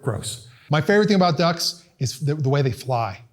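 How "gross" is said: quiet. Retold or imitated. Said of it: "I'm like".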